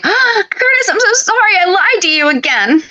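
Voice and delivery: high-pitched, melodramatic soap confession; mock apology. Co-host acting out the soap heroine confessing to Curtis, mock apologetic.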